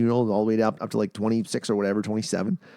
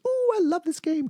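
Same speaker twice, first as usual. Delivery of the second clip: much higher, coy mock voice; coy and teasing; playful wife. The wife asked her age, a high coy mock reply. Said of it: "she goes".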